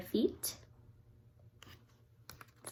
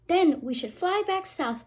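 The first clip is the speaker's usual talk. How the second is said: called out; 6 semitones higher, 5 dB louder than usual. Acted. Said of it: "they called".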